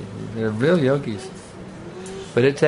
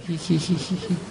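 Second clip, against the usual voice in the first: high squeaky monkey hee-hee, softer; monkey giggle; 6 semitones higher, 5 dB quieter than usual. Retold or imitated. Imitating a monkey running around, high squeaky hee-hee. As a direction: chatter like a playful monkey. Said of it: "Running around".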